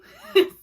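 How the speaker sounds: loud teary laugh; real giddy laugh. Real, not acted. Gushing laugh at the memory.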